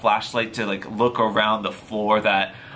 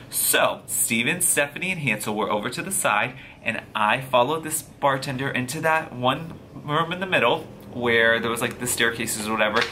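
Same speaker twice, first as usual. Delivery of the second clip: plain reading voice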